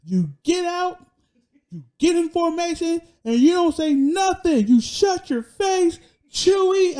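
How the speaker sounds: high, strained mock yell of orders; imitating drill sergeant. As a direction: bark it out like a drill sergeant, drawing out the words. Retelling drill sergeant yelling orders.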